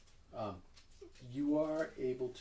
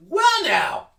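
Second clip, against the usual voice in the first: much higher and much louder, theatrical indignation; grand halfling hostess; 12 dB louder than usual. Acted. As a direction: sweep in and scold like a diva expecting applause. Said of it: "walks like someone expecting applause".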